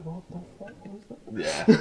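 hushed, laughing disbelief; mock confused stranger; baffled day-tripper.

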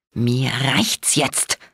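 low, very slow growl; drawn-out low menacing growl. A narrator's reading. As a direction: growl it through slowly, fed up. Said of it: "knurrte ich".

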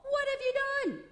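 high, loud accusing cry; shocked outburst. Retold or imitated. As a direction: hurl the question at him in shock. Samuel confronting Saul, shouted.

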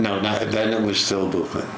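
normal talking voice

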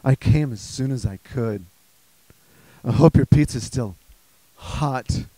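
low, suggestive come-on with pauses before the innuendo. Acted. Prostitute delivering food to a customer, low put-on sultry voice.

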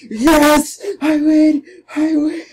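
shouting high with joy; excited cheering. Real, not acted. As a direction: yell out your victory. Wins the fight, cheering.

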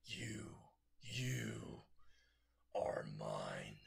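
slow voiceless monster whisper, dropping deep at the end; menacing whisper. Acted. Monster stalks the frozen sludge villain, whispering. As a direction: claim your prey in a hiss of breath.

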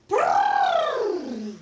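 high loud trunk blast; elephant trumpet. Retold or imitated. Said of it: "He goes".

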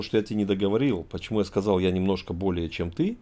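ordinary talk

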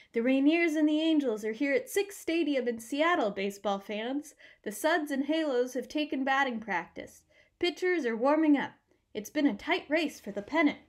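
loud, nasal play-by-play voice; nasal radio announcer. Acted. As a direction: call it like an old radio announcer. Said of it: "Announcer Leo Lassen's nasal voice".

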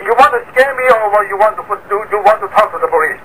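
ordinary delivery

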